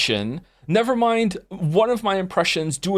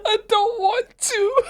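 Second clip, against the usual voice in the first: high, slow laugh-cry whine; slower than usual. Real, not acted. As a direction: whine it through helpless laughter. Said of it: "Can you say Homer?".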